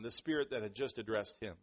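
normal talking voice

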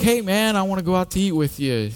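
hammed-up buddy invitation on the phone; upbeat pastor voice. Youth pastor voiced calling Sean.

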